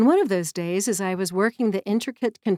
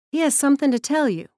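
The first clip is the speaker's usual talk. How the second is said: urgent message; 6 semitones higher, 4 dB louder, faster than usual. Retold or imitated. Bud delivering God's message.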